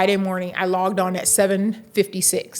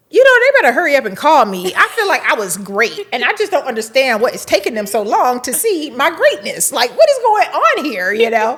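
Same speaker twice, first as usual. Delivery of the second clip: higher, louder mock indignation; impatient; 5 semitones higher, 6 dB louder than usual. Candidate waiting on the university's call, mock impatient. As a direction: huff about not being called yet.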